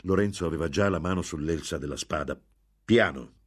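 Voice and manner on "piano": loud, sudden protest; a short, loud cry; shout. A narrator's reading. Lorenzo to the man who bumped him, shouting. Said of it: "gridò".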